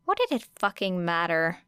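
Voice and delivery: plain reading voice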